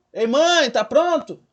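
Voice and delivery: loud, high call to mom. Pretend. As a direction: call mom as if from another room. Example word turned into calling mother.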